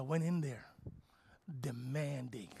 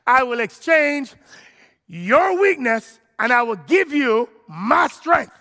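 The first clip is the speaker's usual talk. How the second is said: preaching at full force; 9 dB louder than usual. Acted. Preacher shouting God's promise to the congregation, fervent. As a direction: preach it out, lifting the roof.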